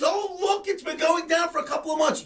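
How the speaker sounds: high, fast sarcastic mimicry; mocking imitation. Host mocks pundits fretting over falling orders, quick sarcastic mimicry. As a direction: rattle off their worry in a high mock voice. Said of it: "you know, when they order".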